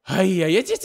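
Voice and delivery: normal talking voice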